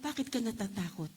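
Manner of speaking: quiet aside. Shown as a demonstration. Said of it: "Sabi mo nga sa katabi mo".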